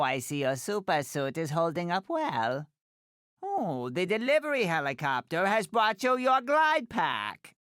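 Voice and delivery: ordinary delivery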